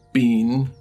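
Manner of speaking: usual speaking voice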